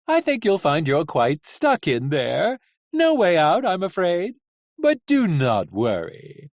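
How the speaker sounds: theatrical villain with swooping pitch; showy, lilting menace; gloating coachman. Acted. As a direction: toy with the captives, stretching key words. Coachman gloats over trapped captives.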